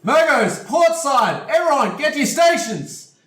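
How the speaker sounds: loud, high, full-force command to the crew; captain's shout. Acted. Game master as ship's captain rallying the crew, urgent shout. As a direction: bellow orders across the deck, voice pitched right up. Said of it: "and he shouts".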